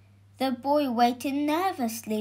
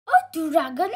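higher, louder startled question; startled yelp; 5 semitones higher, 5 dB louder, faster than usual. A narrator's reading. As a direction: yelp it as an alarmed wife. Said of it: "yelped the wife".

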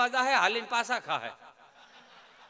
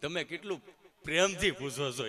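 dull villager; 7 semitones lower than usual. Retold or imitated. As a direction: drag it out like a dim villager. Gabho answers slowly. (ખૂબ નીચો, ધીમો, ઢીલો અવાજ)

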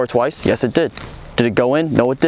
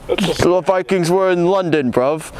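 higher-pitched mock British accent; hammed-up British accent; 5 semitones higher than usual. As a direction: push the bad London British accent harder, pitched up. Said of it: "what's a British word?".

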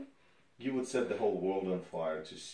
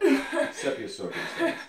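high, slow laughing taunt; mocking laughter; 8 dB louder than usual. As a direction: laugh through it, mocking her. Mocking captive while laughing.

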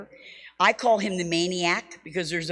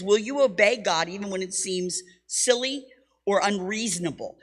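ordinary delivery

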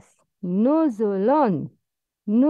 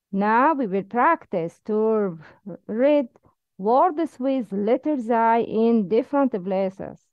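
usual speaking voice

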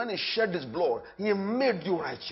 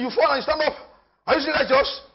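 usual speaking voice

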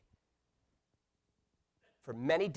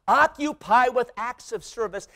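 usual speaking voice

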